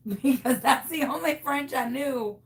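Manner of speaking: ordinary delivery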